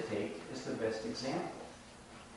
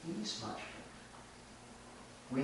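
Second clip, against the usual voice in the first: quiet aside; 16 dB quieter, faster than usual. Shown as a demonstration. Repeating the goat's offer to the listeners, hushed aside. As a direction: drop it to a sly hush.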